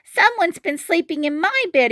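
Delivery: high squeaky little cub; tiny bear finds his bed taken; Baby Bear crying. Acted. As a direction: pitch way up, small and upset. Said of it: "he cried".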